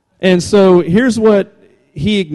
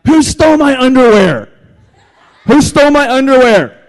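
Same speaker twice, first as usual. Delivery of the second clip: higher, louder hammed-up tantrum shout; mock angry yell; 8 semitones higher, 7 dB louder than usual. Pastor imitating Tom Brady yelling at teammates. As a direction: rant like a furious quarterback over lost underwear. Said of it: "I just picture him saying something like".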